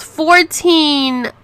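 high, loud, drawn-out disbelief; hammed-up astonished repeat; incredulous quote. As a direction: blurt the number in mock shock. Acts out shocking answer about kids' ages.

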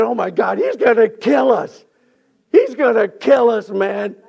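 hammed-up terror at full volume; acted panic. Brothers fearing Joseph will kill them, acted panic.

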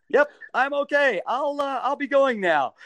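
high, over-bright reassurance; hasty brush-off. Retold or imitated. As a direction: insist you're fine while making an escape. Acting his hasty exit after waking.

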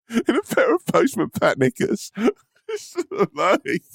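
high, slow words through laughter; laughing while talking. Real, not acted. Laughing at the picture of him in cartoon pants. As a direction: giggle through the image of Postman Pat knickers. Laughing at Postman Pat image.

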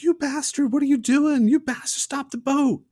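high, loud, panicked scream; mock screaming. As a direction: scream at the boat driver in terror. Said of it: "Chet starts screaming to Roman".

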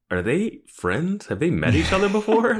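rising in disbelief, voice climbing; mock bewildered viewer asking; incredulous. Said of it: "I was like".

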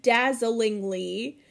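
"dazzlingly" slowly, with an L after the zz; correct pronunciation. Shown as a demonstration. Host proving she knows the word, "dazzlingly" slow and complete. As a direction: lay out "dazzlingly" syllable by syllable, measured. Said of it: "know the word is".

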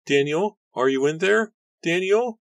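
high, loud desperate calls; calling out. A narrator's reading. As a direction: call his name into the fire. Nora calling into burning house.

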